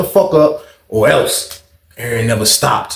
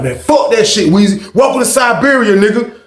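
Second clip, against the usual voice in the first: defiant, higher-pitched taunt; acted anger; 8 semitones higher than usual. Angry inmate dismissing his neighbour, defiant taunt. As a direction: imitate an angry inmate brushing you off. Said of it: "but he is angry".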